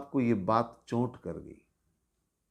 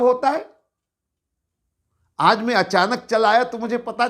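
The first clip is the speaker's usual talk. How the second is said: furious, higher and louder scolding; angry master; 8 semitones higher, 4 dB louder than usual. Acted. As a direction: tear into the servant girl. Nawab lashing the maid. (गुस्से में डांटते हुए)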